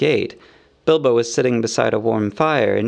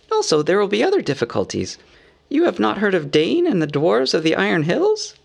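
high hobbit voice, rising to a query; businesslike hobbit; 7 semitones higher than usual. A narrator's reading. Bilbo asks if they know of Dane, businesslike. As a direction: raise a further difficulty, high and polite.

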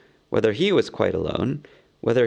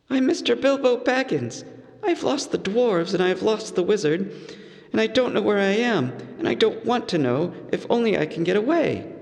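higher, lost and worried hobbit; anxious hobbit; 10 semitones higher than usual. A narrator's reading. Bilbo, lost and frightened, introducing himself to Gollum. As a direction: plead to be let go.

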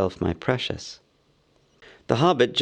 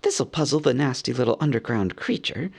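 ordinary delivery, nothing marked

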